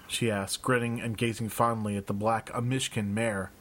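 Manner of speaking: usual speaking voice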